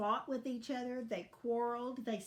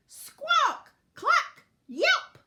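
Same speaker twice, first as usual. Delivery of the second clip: loud, high animal squawks and yelps; squawking hens; 13 dB louder, much slower than usual. A narrator's reading. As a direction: burst out squawking, one cry at a time. Hens and weasel fighting noises.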